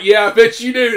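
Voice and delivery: sarcastic loud mock comeback; sarcastic retort. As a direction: throw it back at the screen. Retold sarcastic reply to character.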